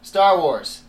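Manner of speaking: ordinary delivery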